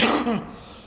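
hacking smoker's cough; rough raspy cough from the chest. Real, not acted. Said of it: "it's smoker's cough".

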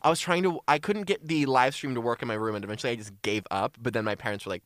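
usual speaking voice

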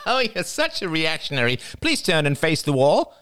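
high, laughing scorn; Jada, mocking. A narrator's reading. Jada mocking her reactionary cousin, high gleeful scorn. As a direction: dismiss him in a high nasal voice.

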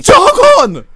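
high, loud furious shout. Retold or imitated. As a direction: yell at the cyclist to get lost. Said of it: "he just screams at the guy".

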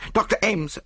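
high-pitched alarmed call for the doctor; frantic summons; urgent cry. A narrator's reading. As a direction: call out in sudden panic. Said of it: "I cried".

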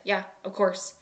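ordinary talk, nothing marked

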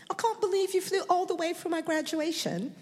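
high mock British accent. Niece thanking her aunt for flying over, British accent. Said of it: "Nice accent".

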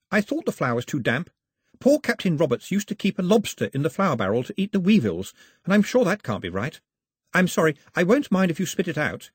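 put-on high, light young girl voice, anxious and polite; a young castaway girl fussing over her guest; girl's voice. A narrator's reading. Girl offering scones to her guest, high and eager.

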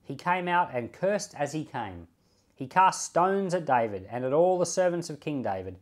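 usual speaking voice

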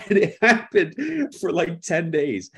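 high, loud, laughing through the words; real laughter breaking up the sentence. Real, not acted. Telling how he was left on speaker, laughing. Laughs at his own story.

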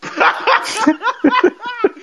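high-pitched real cackle; helpless laughter. Real, not acted. Podcaster cracking up at the tin can jibe, cackling. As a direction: burst out laughing, high and long.

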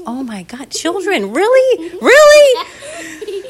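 high incredulous dad; exasperated disbelief. Acted. Dad sees water everywhere, in rising exasperated disbelief. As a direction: climb to a loud, squeaky second question.

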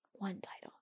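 soft whispered aside. Real, not acted. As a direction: breathe it almost silently.

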